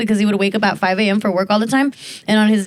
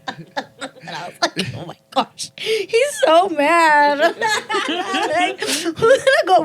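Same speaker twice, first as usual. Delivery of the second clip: laughing; 9 semitones higher, much slower than usual. Real, not acted. Laughing while retelling.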